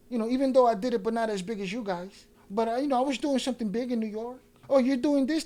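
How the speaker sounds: high-pitched parody of a bragger; mocking humblebrag. Mimicking a man bragging about New York, mocking and high. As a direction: imitate him boasting, voice lifted high.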